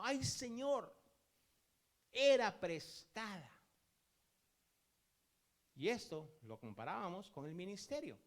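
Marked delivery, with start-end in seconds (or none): 0.0-3.5 loud, high cry of dismay. Acted. Man who dropped the borrowed axe in the water, crying out. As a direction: call out to the Lord, stretching the last words. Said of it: "Y le gritó".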